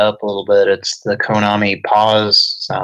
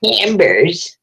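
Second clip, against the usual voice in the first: high, drawn-out brand name call; old lady ad voice; 9 semitones higher than usual. Retold or imitated. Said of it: "Camber's commercials".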